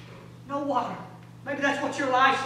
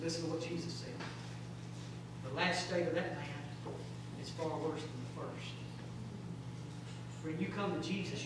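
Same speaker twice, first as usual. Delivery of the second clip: soft, low and solemn; hushed preaching appeal; 6 semitones lower, 12 dB quieter than usual. Real, not acted. Preacher pleading for a whole heart for Jesus, hushed appeal. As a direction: bring it right down to an earnest plea.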